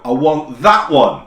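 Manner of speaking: loud, slow, mock talking to foreigners; loud tourist voice. Holidaymaker addressing a Spanish person, loud and slow. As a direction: imitate someone talking as if to the deaf. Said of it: "Talking to them in a loud voice as if they're deaf".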